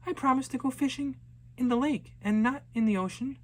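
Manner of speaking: put-on high, fast, bright little girl announcing plans; eager young girl. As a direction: play the excited girl set on fishing. Girl promises her mother.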